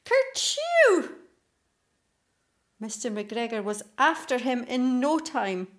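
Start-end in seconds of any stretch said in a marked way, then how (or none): 0.0-1.1 loud, high sneeze; a sudden comic kerchew. A narrator's reading. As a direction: sneeze it out big and loud like a small rabbit. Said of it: "Peter sneezed".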